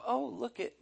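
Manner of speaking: mock the meek woman's awe, trailing off; soft, small imitation of a meek woman; mousy, meek. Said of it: "She wasn't being this mousy, meek kind of".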